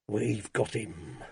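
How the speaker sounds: slow, low, quiet gloat; drawn-out, softly triumphant; grinning triumph. A narrator's reading. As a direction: savour the victory through a grin. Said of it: "Meacher was grinning broadly".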